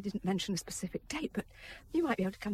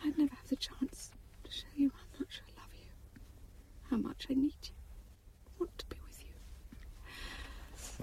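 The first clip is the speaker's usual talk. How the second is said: tearful whisper; 5 semitones higher, 6 dB quieter than usual. Acted. Wife confessing love, tearful.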